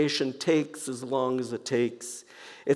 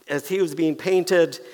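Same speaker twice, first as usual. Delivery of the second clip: normal talking voice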